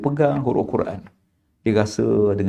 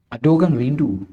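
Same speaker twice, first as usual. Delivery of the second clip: normal talking voice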